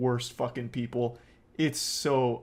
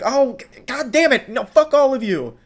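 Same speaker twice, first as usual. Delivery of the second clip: much higher, louder sputtering rage; imitating an enraged woman cursing at a crowd; mock furious outburst; 11 semitones higher, 8 dB louder than usual. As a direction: spit the curses out in a frustrated fit. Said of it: "And then she's like".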